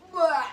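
disgusted gagging blah. Real, not acted. Gagging on fermented fish.

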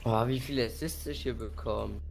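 ordinary talk

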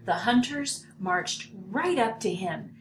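ordinary delivery, nothing marked

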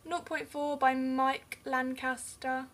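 usual speaking voice

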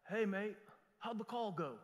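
imitated English accent, a bit uncertain. English friend calls pastor about the call, imitated English accent. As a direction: put on a soft, wobbly English accent. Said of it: "The English guy?".

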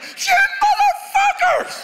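very high, slow shrieking yell; high-pitched yell. Retold or imitated. Upset diver yelling at the boat kids, very high shriek. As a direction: screech it way up high in outrage. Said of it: "I was like".

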